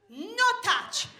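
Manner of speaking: high-pitched mock-Italian toddler; toddler's Italian accent. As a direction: say it as a little Italian child. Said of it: "she sounded Italian".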